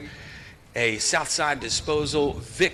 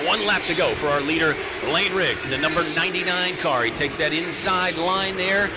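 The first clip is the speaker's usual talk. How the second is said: normal talking voice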